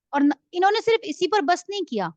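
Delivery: preacher's forceful outburst on صرف. Real, not acted. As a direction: hit صرف with force, rising up in alarm. Preacher repeats point with emphasis.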